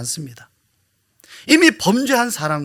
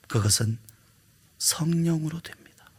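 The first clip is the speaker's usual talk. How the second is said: much softer, whispered answer; 6 dB quieter than usual. Real, not acted.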